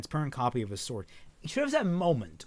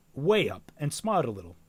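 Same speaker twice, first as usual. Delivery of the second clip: plain reading voice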